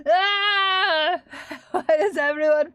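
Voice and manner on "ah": loud, high exasperated "aah"; playful frustrated cry; mock scream. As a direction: let out a sudden loud, high wail. Mock outburst at being sassed.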